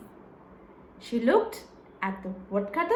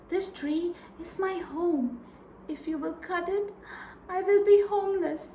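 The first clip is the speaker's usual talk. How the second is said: pleading fairy; 4 semitones higher, 5 dB quieter than usual. Acted. Fairy begging the woodcutter not to cut her tree, high and soft. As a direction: beg him gently to spare your home.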